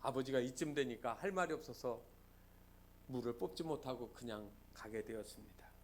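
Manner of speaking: normal talking voice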